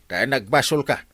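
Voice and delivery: normal talking voice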